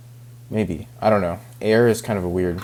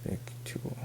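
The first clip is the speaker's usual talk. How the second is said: whispered word; 15 dB quieter than usual. Real, not acted. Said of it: "Victuals? ... what does that mean?".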